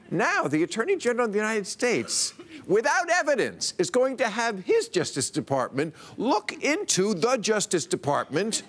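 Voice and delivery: high-pitched, incredulous build-up. Real, not acted. Comedian explains the Justice Department probing itself, incredulous. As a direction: spell out the absurdity, pitch lifted high.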